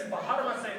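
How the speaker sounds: Pharmacy with a hard h and extra syllables, "ba-har-ma-say"; mispronounced PHARMACY. Retold or imitated. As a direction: stretch Pharmacy into a mangled "fa-har-ma-say". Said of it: "same uncle that can't say pharmacy".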